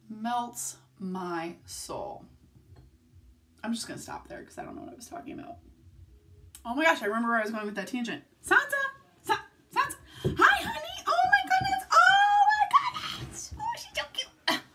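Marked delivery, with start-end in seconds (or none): 0.1-2.2 very slow, savoured melts my soul; drawn-out repeat. Real, not acted. Vlogger repeating her gush about the puppy, very slow. 8.5-14.6 very high, loud squealing puppy talk. Real, not acted. Owner greeting her puppy coming in, high squealing baby talk.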